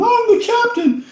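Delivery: high mock hero yell; drunk superhero shout. Imagining himself drunk with the shield, yelling in a high voice. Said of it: "running around the house with this thing going".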